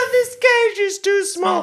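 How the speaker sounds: high cartoon cub complaining; baby bear voice. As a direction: play a tiny cramped bear cub. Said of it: "the baby bear in a cage".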